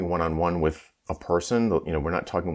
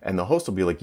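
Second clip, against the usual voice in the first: normal talking voice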